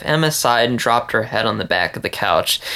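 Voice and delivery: ordinary delivery, nothing marked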